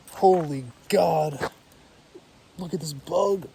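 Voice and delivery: loud, slow startled cry; shocked by a giant bug. Real, not acted.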